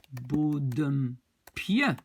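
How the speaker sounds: bodempieu stretched syllable by syllable; very slow sounding-out. Shown as a demonstration. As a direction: draw out each part of "bodempieu" for learners. Teacher sounds out the new form. (bodempieu, très lentement, syllabe par syllabe)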